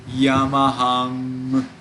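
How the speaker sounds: Yamaham drawn out slowly into a long humming ng; sustained final ng. Shown as a demonstration. Teacher showing students the important ng sound, final ng held in Yamaham. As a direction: say Yamaham slowly and hold the last ng. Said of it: "ng sound is very important".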